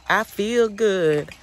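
high, loud, slow [name] imitation. Feeling better, she imitates [name] high, loud and slow. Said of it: "that [name] little dance".

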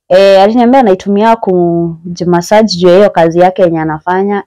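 ordinary talk, nothing marked

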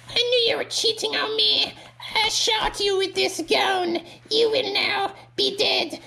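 very high falsetto ogress; woman Shrek. Acted. Jealous ogre lady makes her entrance, high falsetto role voice. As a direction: announce yourself in a squeaky put-on falsetto. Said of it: "woman Shrek".

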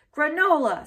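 put-on high, loud little-girl insistence; Kelsey calls out her breakfast pick, loud and high; Kelsey's voice. A narrator's reading. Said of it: "said Kelsey".

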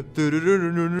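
rolled vocal car sputter; playful mouth-made motor sound; imitated engine noise. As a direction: trill like a car driving off.

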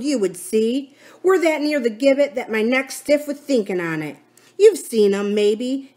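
lightly voiced Silver, scornful and emphatic; grim pirate captain. Silver warns the crew they are near the gibbet, scornful. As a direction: paint the warning for the crew.

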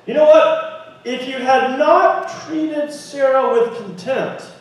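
ordinary delivery

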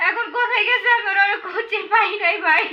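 really wailing plea to a helper; crying. Real, not acted. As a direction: break into sobs while pleading. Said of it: "কান্না করছেন কেন আপনি". (কান্নায় ভেঙে পড়ে বলা)

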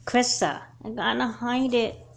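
ordinary talk, nothing marked

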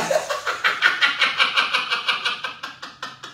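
breathy high burst of laughter; laughing. Real, not acted. Laughing at the sofa she overpaid for. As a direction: crack up at your own bad buy.

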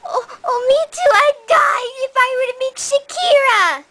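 high-pitched, eager me-too; breathless-to-join-in fan excitement; gushing fan. Acted. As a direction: jump in, swooning over an idol. Second fan joins the gushing.

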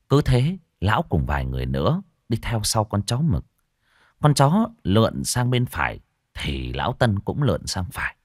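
ordinary delivery, nothing marked

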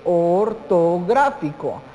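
"ortográfico" drawn out slowly in syllables; slow and syllabified. Shown as a demonstration. Teacher repeats the accent type for students, slowly syllabified.

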